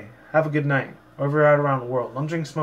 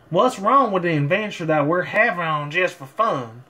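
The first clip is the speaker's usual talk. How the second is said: light Southern drawl as a country pony; easygoing Southern accent, folksy farm pony; mild Texan Southern accent; Applejack's Southern accent; 2 semitones higher than usual. Acted. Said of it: "I tried to do Applejack's Southern accent".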